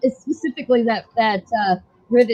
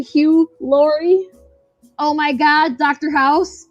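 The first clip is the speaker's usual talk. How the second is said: ordinary talk, nothing marked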